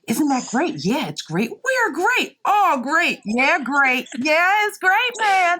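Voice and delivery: high, smug back-patting chorus; mock self-congratulation. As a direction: parody a room of yes-men cheering themselves. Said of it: "than everyone going".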